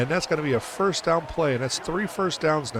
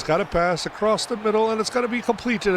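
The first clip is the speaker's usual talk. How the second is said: excited; 9 semitones higher than usual. Real, not acted. Completed pass in play-by-play.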